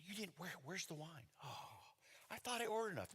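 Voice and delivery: soft, worried half-whisper; flustered under the breath, acted out; hushed, fretful. Retold worried host at wedding.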